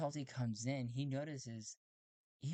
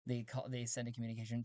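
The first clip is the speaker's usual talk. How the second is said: usual speaking voice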